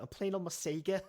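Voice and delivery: high-pitched, hammed-up Scouse; Scouse accent. Shown as a demonstration. Joking about saying Sega, high exaggerated Scouse accent. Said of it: "I'm just doing it in a Scouse accent".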